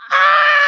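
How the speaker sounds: sudden very high shriek; mom's shriek. Retold or imitated. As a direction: shriek like a startled mom. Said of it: "the mom's like".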